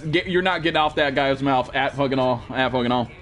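normal talking voice

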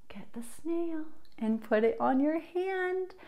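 fast, secretive whisper. Acted. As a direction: whisper as if sneaking up on a snail. Secret snail hunt with kids.

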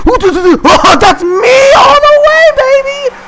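high, loud shrieking; shrieking excited yell. Real, not acted. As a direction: scream the car on to the finish. Neck-and-neck race finish.

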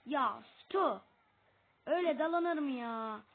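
loud scolding yell at a teammate; real annoyed outburst; exasperated shout. Real, not acted. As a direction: call out the reckless dive. Teammate dives in recklessly.

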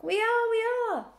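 high, loud, strained crowd cry; chorusing. A narrator's reading. Flock backs the old ewe, chorusing loudly. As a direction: chant agreement at the top of the voice. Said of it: "chorused the flock".

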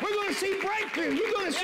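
high, rising, fervent preacher's cry; full-force preaching. Acted. Preacher firing up the congregation, full-force preaching.

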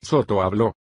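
ordinary delivery, nothing marked

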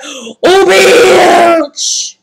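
loud, high, drawn-out exclamation; startled outburst. Retold or imitated. Storyteller relives spotting the cockroach, startled outburst. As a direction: cry out in alarm, stretching the last word.

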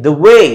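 light, short "the", long weighty "way"; stress on WAY. Shown as a demonstration. Teacher sounding out an iamb, "the" light, "way" stressed. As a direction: skim over "the" and stretch "way". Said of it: "ऐसे बोला गया".

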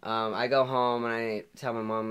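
usual speaking voice

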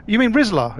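ordinary delivery

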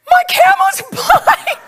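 high, drawn-out comic wail; panicked shout. Retold or imitated. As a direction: shout the alarm to a friend, stretching it out. Screams the news to Jessica.

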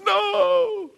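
high, hammed-up wail; sobbing little girl. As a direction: cry it out like a distraught child. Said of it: "just sobbing all over again".

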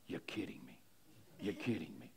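very quiet, low imitated murmur; under his breath. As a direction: act out stunned disbelief, barely audible. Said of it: "he, under his breath".

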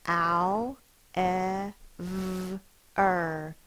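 each sound said apart, slow and clear. Shown as a demonstration. Teacher sounding out a word for children, sound by sound. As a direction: segment the word sound by sound for the class. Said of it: "Let's sound it out".

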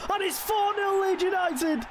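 high, slow, stretched celebration call; excited cheer. Real, not acted. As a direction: draw out the score like a triumphant announcer. Celebrating fourth goal.

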